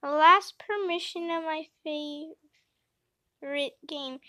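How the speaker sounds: ordinary delivery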